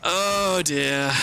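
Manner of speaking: exaggerated dismay; dismayed oh dear. Real, not acted. Run back to lost runes.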